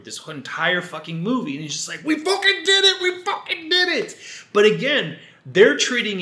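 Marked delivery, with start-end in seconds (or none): 2.0-4.1 high-pitched mock victory cheer; triumphant cry. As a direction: crow it like you just won, way up high. Acting out his triumphant celebration.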